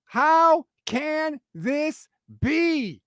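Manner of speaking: very slow, stretched, pleading; drawn-out disbelief. Real, not acted. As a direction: let each word hang in despair. Repeated, drawn out.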